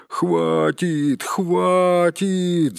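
high, drawn-out protesting call; acted group outcry; crowd's cry. A narrator's reading. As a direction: cry out in protest, stretching each word. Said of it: "закричали остальные".

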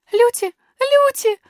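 very high, loud, drawn-out wail; acted high-pitched whimper; sobbing princess. A narrator's reading. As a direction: call the nurse in a tearful little girl's voice. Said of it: "всхлипнула принцесса".

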